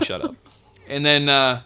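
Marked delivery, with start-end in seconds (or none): 0.1-0.4 "Shut up." raised much higher but soft. Retold or imitated. Officer silencing the traveller again, higher-pitched command. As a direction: lift "Shut up." high in pitch. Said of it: "Told me".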